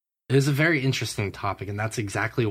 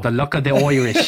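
louder, mock Irish lilt; sing-song Irish accent put on for a laugh; 3 semitones higher, 6 dB louder than usual. Cohost jokes with a louder Irish accent. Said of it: "I wanna see Fish pull off an accent".

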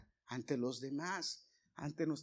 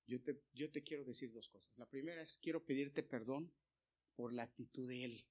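much softer, lowered voice; humble apology; 3 semitones lower, 8 dB quieter than usual. Retold or imitated. Speaker apologizing to his boss for the coworker, soft and humble.

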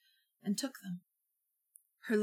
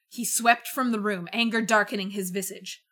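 ordinary delivery, nothing marked

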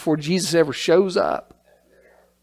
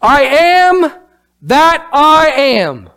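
slow, booming voice of God, louder and higher; 5 semitones higher, 3 dB louder, much slower than usual. Acted. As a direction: proclaim it grandly, drawing out each word. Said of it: "And God said to Moses".

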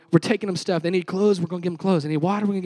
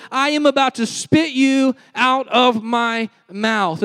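slow, higher, louder proclamation; scripture voice of God; 6 semitones higher, 6 dB louder, slower than usual. Acted. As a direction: declare the verse with weight. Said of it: "he says".

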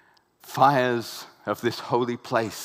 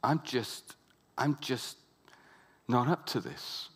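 softer, hushed self-doubt; crushed inner voice; 6 dB quieter than usual. Retold or imitated. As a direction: murmur it as if to yourself. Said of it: "where you think".

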